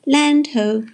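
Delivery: high, loud lookout cry; yelling. A narrator's reading. Pete from the crow's nest sighting shore, yelling. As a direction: holler it long from the mast. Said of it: "yelled Pete".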